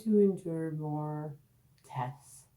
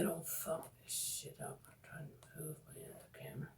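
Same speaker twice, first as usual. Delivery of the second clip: muttering to herself under her breath; whispered aside; 15 dB quieter, faster than usual. Real, not acted. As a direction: whisper while fiddling with the camera. Aside while moving camera.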